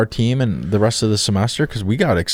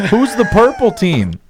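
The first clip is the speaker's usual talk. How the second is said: higher-pitched mocking quote of a clueless question; 9 semitones higher, 3 dB louder than usual. Co-host mimicking how the teammate asked, clueless question. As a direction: blurt it out as a naive asker, exaggerated. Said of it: "the way that he asked".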